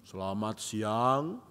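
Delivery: low, slow, soft greeting from a feared teacher; stern old teacher. Retold or imitated. Feared old teacher greeting the class, low and slow. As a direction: let each word drop slowly and heavily. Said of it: "dia cuma begini".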